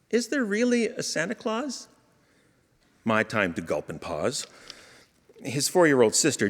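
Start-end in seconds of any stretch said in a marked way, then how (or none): 0.1-1.9 put-on high, soft, hesitant little boy; timid child asking. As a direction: ask it like a nervous small child. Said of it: "a gulp and a long pause".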